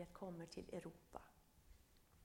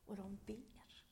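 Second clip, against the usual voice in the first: "Och de ber" whispered slowly and softly; 3 dB quieter, slower than usual. Shown as a demonstration. Preacher hushing the congregation on "Och de ber", whispered. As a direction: let "Och de ber" fall to a slow hush. Repeats "they pray" for emphasis.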